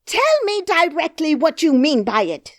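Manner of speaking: loud high screaming demand. Acted. Nurse screams at the princess, loud and high. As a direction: scream it, half wild with anger. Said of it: "screamed the nurse".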